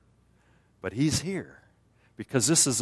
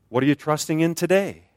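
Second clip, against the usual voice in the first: normal talking voice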